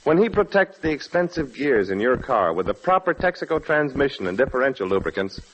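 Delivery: ordinary talk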